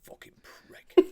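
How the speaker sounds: whispered, slow, voiceless cursing; disapproving mutter. Retold or imitated. Father of the bride watching with arms crossed, disapproving mutter. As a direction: mutter it under your breath, imitating a fuming onlooker. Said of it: "stood there with his arms crossed going".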